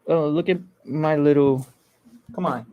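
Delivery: ordinary talk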